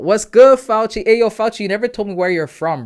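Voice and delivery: high and loud, hyped greeting; excited call-out. Real, not acted. Streamer greets a chatter Fauci by name, hyped call-out.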